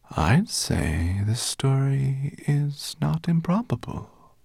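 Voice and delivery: high, slow, drawn-out serpent voice; sea serpent. A narrator's reading. The serpent tells Firedrake old tales, slow and lilting. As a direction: stretch the words like an ancient sea serpent.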